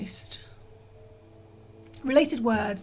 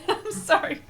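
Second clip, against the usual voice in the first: laughing sorry, pitched way up; laughing apology; 10 semitones higher, 3 dB louder than usual. Real, not acted. Apologizing to viewers after a blanket fight, laughing. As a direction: giggle through the apology.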